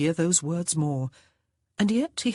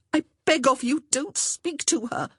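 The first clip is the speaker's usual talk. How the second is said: put-on higher, frantic young woman cutting in; frantic plea; 10 semitones higher than usual. Honor cuts George off, frantic and pleading. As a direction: rush in, desperate to stop him. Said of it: "interjected frantically".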